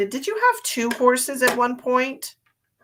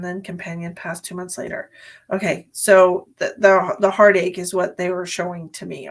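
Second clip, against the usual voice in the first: usual speaking voice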